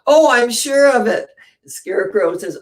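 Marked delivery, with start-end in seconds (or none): 0.1-1.3 higher and louder mock-certain Tin Man. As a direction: sound spooked but sure. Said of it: "what did the Tin Man say".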